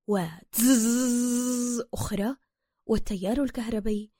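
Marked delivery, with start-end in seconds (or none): 0.1-1.8 second drawn-out electric zzz; taser buzz. A narrator's reading. Narrator imitates another taser shock, drawn-out buzz. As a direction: buzz it long like a razor. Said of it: "الشبيه بصوت ماكينة الحلاقة الكهربائية".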